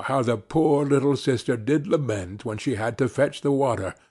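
ordinary delivery, nothing marked

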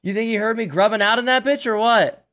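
excited disbelief. Real, not acted. Just killed, frustrated disbelief.

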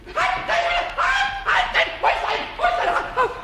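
high-pitched flustered cries; frantic searching. Acted. Pumuckl hunts for the runaway mouse, high and frantic. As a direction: squeal after a runaway in a fluster.

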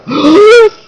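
sharp, very high, loud startled gasp; shocked squeal of a gasp. Real, not acted. Sees Stefano.

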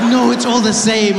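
high, loud mother, drawn out; insistent mom. Retold or imitated. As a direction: imitate mom brushing off the comparison. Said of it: "She's like".

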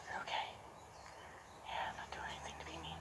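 hushed, gentle reassurance to a nervous horse; soft calming whisper; soothing whisper. Real, not acted. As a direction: whisper it so only the horse hears. Soothing a scared horse up close.

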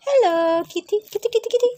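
high, loud kitty call; cooing kitty call. Real, not acted. Calling the kittens.

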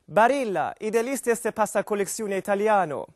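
Italian ad voice. Retold or imitated. Comic reads Italian pasta ad in mock Italian. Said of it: "på italienska".

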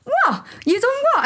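high, incredulous what; mock-shocked listener gasping what; shocked disbelief. People stunned by her life story, high disbelieving what.

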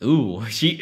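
ordinary delivery

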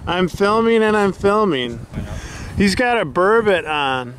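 normal talking voice

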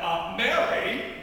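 loud, drawn-out call of a name; perturbed and emphatic, stretching the words. Acted. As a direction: call her name slowly, somewhat put out. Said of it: "somewhat perturbed".

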